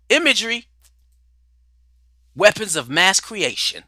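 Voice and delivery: ordinary talk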